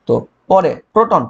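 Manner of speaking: ordinary talk, nothing marked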